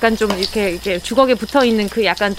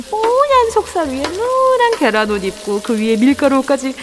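playful sing-song; 4 semitones higher than usual. Acted. Playful food narration.

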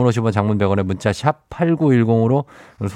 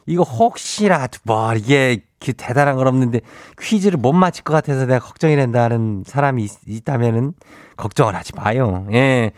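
Chungcheong dialect; 6 semitones higher than usual. Acted. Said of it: "행진리 이장인데요".

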